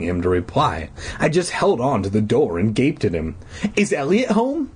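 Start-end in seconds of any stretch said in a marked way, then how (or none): 3.8-4.7 high, polite question; polite boy voice. A narrator's reading. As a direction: ask it like a neat kid. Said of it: "Buddy asked".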